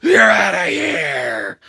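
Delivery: slow, drawn-out referee call; mock referee ejection. Referee ejecting Lana from ringside, slow drawn-out call. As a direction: drag it out like an umpire tossing someone. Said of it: "He's like".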